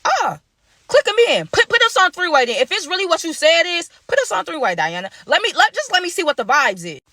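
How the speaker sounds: high, pushy, worked-up demand; retold heated demand. Narrator retelling how he pushed his date to conference the caller, high and insistent. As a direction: insist she call him in now.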